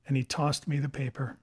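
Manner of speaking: plain reading voice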